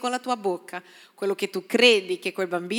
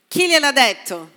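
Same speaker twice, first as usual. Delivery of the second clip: louder, pressing question; emphatic challenge; 3 semitones higher, 6 dB louder than usual. Acted. Pastor challenging the congregation loudly, pressing. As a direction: demand an answer, pushing.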